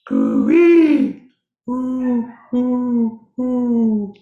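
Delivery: high, loud dove coos; dove cooing. Shown as a demonstration. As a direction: coo like a mourning dove. Imitating doves within the poem.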